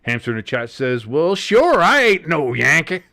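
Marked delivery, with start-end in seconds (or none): 1.1-3.0 louder, slightly high Southern drawl; mock Southern accent. Host reads Hamster's chat line aloud in a louder mock Southern accent. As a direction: read the chat like a proud Southerner. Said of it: "Probably sounds exactly like that".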